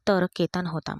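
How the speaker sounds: ordinary delivery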